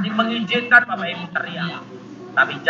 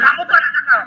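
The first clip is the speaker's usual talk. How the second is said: put-on scolding child; 9 semitones higher, 5 dB louder than usual. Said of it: "Jadi saya nggak bilang".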